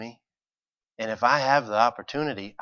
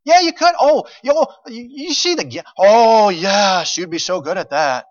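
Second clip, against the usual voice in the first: much higher, gushing praise; gushing flatterer; 12 semitones higher, 9 dB louder than usual. Retold or imitated. Mocking the crowd that pumps our ego, high gushing. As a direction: coo like an over-eager flatterer. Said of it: "we want everybody around us to tell us".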